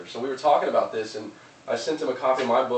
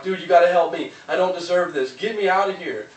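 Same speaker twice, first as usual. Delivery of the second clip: higher, louder urgent begging; desperate plea; 7 semitones higher, 7 dB louder than usual. Retold or imitated. Man in hole begs random dude, loud urgent plea. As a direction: plead with the random passerby. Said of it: "the guy in the hole's like".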